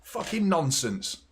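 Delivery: usual speaking voice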